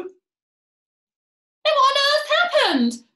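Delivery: very high, loud, drawn-out wail; distressed cry. A narrator's reading. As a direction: cry out in hammed-up panic. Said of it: "cried Missus Twit".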